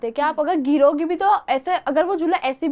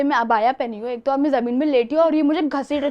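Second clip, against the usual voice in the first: ordinary delivery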